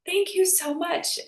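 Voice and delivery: high adoring gush; melting over baby deer. Retold or imitated. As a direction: swoon over the tiny fawn. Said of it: "my heart just melted".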